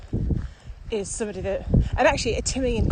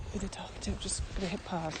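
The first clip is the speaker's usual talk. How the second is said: drops much softer, as if not to be overheard; hushed; 12 dB quieter, faster than usual. Real, not acted. Spotting suspicious people nearby.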